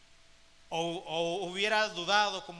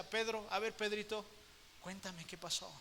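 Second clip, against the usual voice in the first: much softer, tender and compassionate; voz de amor; 11 dB quieter than usual. Acted. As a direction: coax him gently like a loving friend. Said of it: "Con esa voz de amor". (voz tierna y compasiva, mucho más suave)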